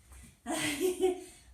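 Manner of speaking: a loud, breathless burst of laughter; loud laugh after the crawl. Real, not acted.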